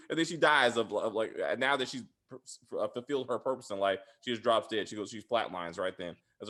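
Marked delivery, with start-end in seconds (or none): none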